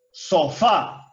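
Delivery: "Sofá" alone, weight on the last syllable, so-FÁ; stress on final syllable. Shown as a demonstration. Teacher giving the class an example, "Sofá" stressed on FÁ. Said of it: "Esa última sílaba que lleva la mayor fuerza de voz".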